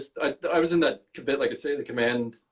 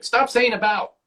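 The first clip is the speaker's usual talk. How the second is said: aunt scolding; 13 semitones higher than usual. Retold or imitated. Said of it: "she's like".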